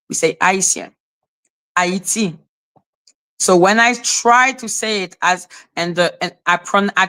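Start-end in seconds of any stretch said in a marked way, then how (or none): 0.4-2.4 Haitien, Haiti with no H sound; French pronunciation. Shown as a demonstration. Showing French lacks an H sound, French pronunciation. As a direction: say Haitien and Haiti as ah-ee-syen, ah-ee-tee. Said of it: "We don't have ... sound in French".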